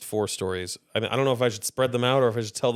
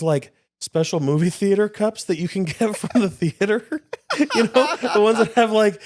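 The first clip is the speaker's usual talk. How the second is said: laughing; 10 semitones higher, 5 dB louder than usual. Real, not acted.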